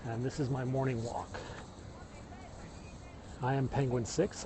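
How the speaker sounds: usual speaking voice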